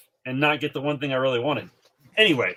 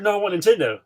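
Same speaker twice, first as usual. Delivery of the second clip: put-on kid insisting; 8 semitones higher, 6 dB louder, faster than usual. Kid refusing, retold.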